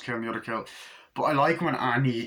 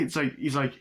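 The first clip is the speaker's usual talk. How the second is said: ordinary talk, nothing marked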